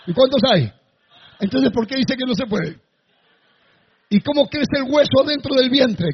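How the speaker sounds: usual speaking voice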